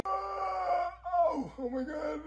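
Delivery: high startled cry of alarm; shocked cry. Acted. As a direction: jolt up in sudden shock. Friend suddenly collapses in pain.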